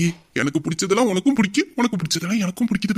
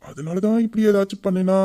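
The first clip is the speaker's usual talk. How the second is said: ordinary delivery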